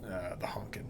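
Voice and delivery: whispered aside about the honking. Acted. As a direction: let it slip as a hushed secret.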